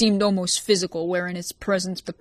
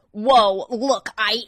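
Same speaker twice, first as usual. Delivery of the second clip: high, loud, slow nervous stammer; a cornered man stammering excuses; 5 semitones higher, 7 dB louder, much slower than usual. A narrator's reading. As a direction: stumble over it, backing away. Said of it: "he stammered".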